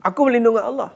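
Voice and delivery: loud, defiant vow of refuge; a firm, fearless inner declaration. Shown as a demonstration. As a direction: declare it boldly, standing your ground. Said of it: "kena ada satu percakapan begitu".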